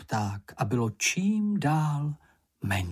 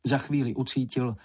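ordinary delivery, nothing marked

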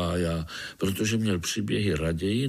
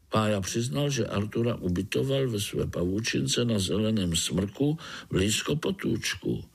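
plain reading voice

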